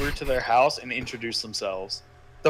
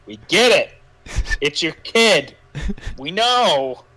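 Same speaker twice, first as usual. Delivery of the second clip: exasperated; 4 semitones higher, 6 dB louder, slower than usual. Pretend. Fed up with the repeated word.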